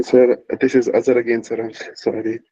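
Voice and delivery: normal talking voice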